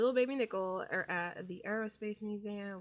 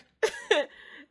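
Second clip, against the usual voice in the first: real laugh, high and loud; bursting out laughing; loud burst of laughter; 10 semitones higher, 12 dB louder than usual. Real, not acted. Reacting to half-brother reveal.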